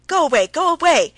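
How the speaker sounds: loud, high shriek of pain; wife shrieks at her husband to leave. A narrator's reading. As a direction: shriek as if physically hurt. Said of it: "she shrieked".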